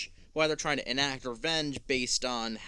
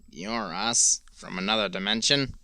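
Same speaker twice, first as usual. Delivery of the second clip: put-on drunk cowboy voice; 5 semitones lower, 6 dB louder than usual. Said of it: "you sound like a drunk cowboy".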